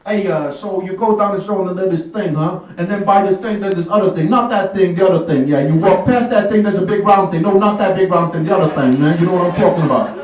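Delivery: loud New York Italian street voice; hammed-up New York Italian accent. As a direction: give directions like a tough New York Italian guy. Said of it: "They're gonna talk like New York Italians".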